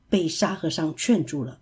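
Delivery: plain reading voice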